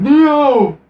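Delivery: high, loud movie-hero yell; mock shout. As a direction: holler the name in hammed-up alarm. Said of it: "as not Morpheus is shouting".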